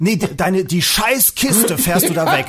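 high, drawn-out mock shout; bossy young cop's yelled order, imitated; imitated police yelling. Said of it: "Wirklich in diesem Tonfall". (nachgeahmter, lauter Befehlston)

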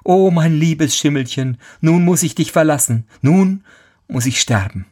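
high, mildly wailing farewell; a touch of storybook despair; lamenting. Acted. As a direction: grieve over leaving the horse. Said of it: "jammert immerfort".

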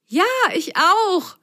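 high, gleeful little cry; high joyful whoop. Acted. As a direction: squeal it out happily. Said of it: "juchzte Apfelgrün".

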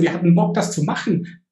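ordinary delivery

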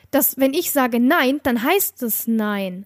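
slows right down on "nein heißt nein"; slow and firm. Real, not acted. Telling the stranger off to friends, slow and firm. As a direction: spell out each word, no room for argument. Insisting no means no.

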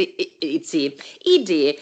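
stumbling after swallowing wrong; throat still catching; choking on a drink. Real, not acted. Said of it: "ich hab mich verschluckt".

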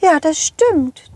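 ordinary delivery, nothing marked

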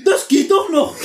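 high laughing mock quote; mock careless friend. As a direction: play him shrugging it off, laughing. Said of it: "und sagen".

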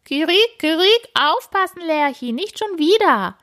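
high clucking warning; cackling partridge. A narrator's reading. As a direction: cluck it high and urgent like a hen. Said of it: "gackert es vom Feldrand".

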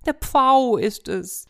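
high, slow insistent cry; hen calling out. Acted. Hen calling out to the cat, high. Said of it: "rief die Henne".

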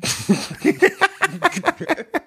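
high burst of laughter. Real, not acted. Comedian amused by his retold question, high laughter. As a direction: crack up in high, drawn-out laughter.

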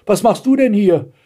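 high-pitched astonished question. A narrator's reading. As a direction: ask it in girlish amazement. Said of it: "fragte Blaustrümpfchen erstaunt". (erstaunt, mit hoher Stimme)

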